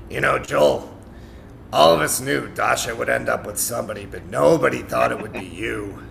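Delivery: lightly put-on friend voice; a touch of imitating a bandmate; Mark's voice. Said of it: "You do a good Mark".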